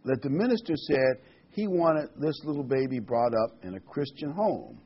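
ordinary talk, nothing marked

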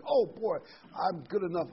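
high, put-on voice of someone pleased with himself; mock self-congratulation. Preacher imitating one who thinks himself worthy, mock delight. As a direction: parody a person sure of his own merit.

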